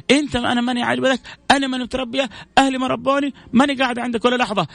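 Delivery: high, offended outburst; upset wife. Acted. Angry wife telling her husband she is leaving, high and offended. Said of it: "تجيك هي زعلانة".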